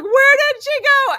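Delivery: very high, loud panicked question; retold frantic yell. Mom searching for lost daughter, frantic high yell. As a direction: wail it like a frantic parent. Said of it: "that was my moment".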